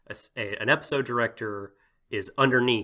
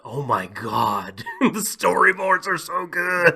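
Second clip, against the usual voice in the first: drawn-out, higher-pitched awe at the end; gushing mock amazement; 6 semitones higher, 4 dB louder, slower than usual. Podcaster imitating a mangaka seeing the preview, gushing slowly. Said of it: "he was like".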